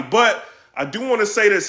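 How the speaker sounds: ordinary talk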